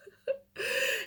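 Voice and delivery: a high laugh breaking into tears; choked, teary laugh; tearful laugh. Real, not acted. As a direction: laugh through real tears. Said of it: "I'm trying not to cry".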